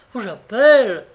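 shouted threat. A narrator's reading. Repeats the threat louder.

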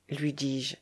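plain reading voice

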